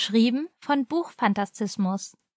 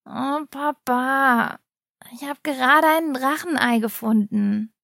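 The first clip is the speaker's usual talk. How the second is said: grumbling. A narrator's reading. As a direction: grumble it like a sleepy, sulky boy. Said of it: "murrte er".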